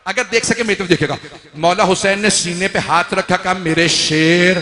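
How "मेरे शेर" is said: slow, louder fond address; tender call. A narrator's reading. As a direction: call him gently but firmly, slowly. Hussain restrains Abbas. (धीमे, प्यार भरे स्वर में पुकार)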